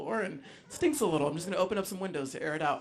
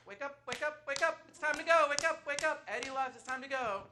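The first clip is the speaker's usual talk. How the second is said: high, soft sing-song wake-up call; dad gently rousing the leftover partygoers, lightly mocked; dad waking guests; 12 semitones higher, 6 dB quieter, faster than usual. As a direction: coax sleepers awake in a light high voice.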